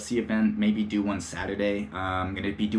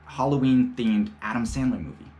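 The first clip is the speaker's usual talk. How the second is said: ordinary talk, nothing marked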